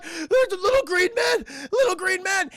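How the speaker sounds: high, loud, hammed-up crying voice; mock terrified wail. Husband telling wife what chases him, mock terrified wail. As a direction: wail it like a panicking man.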